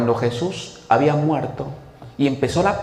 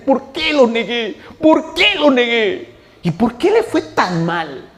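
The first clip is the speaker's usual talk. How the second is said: weeping Peter; 8 semitones higher, 4 dB louder, slower than usual. Retold or imitated. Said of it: "empezó a llorar y a llorar".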